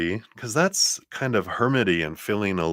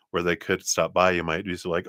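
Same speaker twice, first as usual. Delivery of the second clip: normal talking voice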